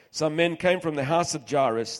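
ordinary talk, nothing marked

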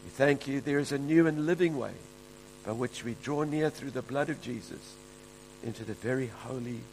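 soft, hushed prayer voice. Real, not acted. Pastor praying over the congregation in a soft, hushed voice. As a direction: pray it quietly and reverently.

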